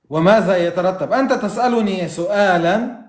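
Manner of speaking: ordinary talk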